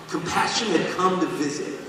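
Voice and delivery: ordinary delivery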